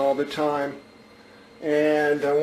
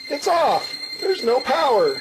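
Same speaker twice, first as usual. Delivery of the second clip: sudden high surprised outburst; 9 semitones higher than usual. Real, not acted. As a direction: caught off guard, pitch jumps up. Speakers suddenly cut out.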